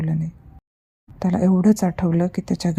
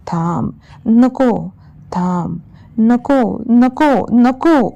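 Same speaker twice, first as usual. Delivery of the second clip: slow, loud pleading that climbs higher and louder; desperate scream; 5 semitones higher, 6 dB louder, much slower than usual. Acted. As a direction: beg for your life, rising to a shriek. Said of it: "तो जीवाच्या आकांतानं किंचाळला". (जीवाच्या आकांतानं किंचाळणं)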